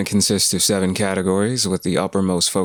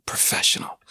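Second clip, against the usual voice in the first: breathy stage whisper, much softer; joking whisper; 6 dB quieter than usual. Acted. Host mocking his own wording, a soft whispered aside. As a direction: slip it in as a dry aside under your breath.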